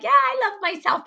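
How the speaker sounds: high, breezy, offhand "Yeah, I love myself"; high, glib and breezy; mock casual claim. Mocking a casual self-love claim.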